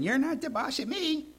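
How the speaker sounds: put-on high, pouting little-kid voice; defiant toddler. Preacher mimics a toddler defying mom and dad, high and pouty. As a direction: sass back like a two-year-old. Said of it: "like a two-year-old shaking their fist".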